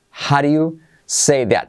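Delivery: "How do you" said slowly as one word, "haɾju"; slow "howdya say that" with a flapped d; slow contracted demo. Shown as a demonstration. Teacher spells out the contraction slowly for students. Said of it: "lo contraen en how do you".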